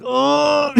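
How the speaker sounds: long, very high "oh"; hammed-up groan of hard impact; imitated pained cry. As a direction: wail it as if hitting the ground. Mimics crashing off the caravan.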